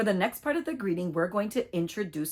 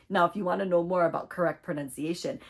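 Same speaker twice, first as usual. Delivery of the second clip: ordinary delivery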